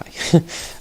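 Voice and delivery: high, breathy burst of laughter at a bad buy; disbelieving laugh. Real, not acted. Coach laughing at the player's bad item choice, disbelieving laugh.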